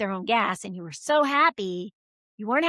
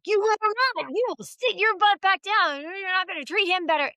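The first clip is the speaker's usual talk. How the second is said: mock stern mom; 8 semitones higher than usual. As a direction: play the indignant mother. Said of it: "And I was like".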